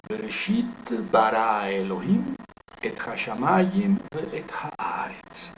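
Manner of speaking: slow, measured Hebrew scripture reading; poetic recitation. Shown as a demonstration. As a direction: recite the verse with drawn-out stressed syllables. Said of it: "a la lectura poética, a la recitación".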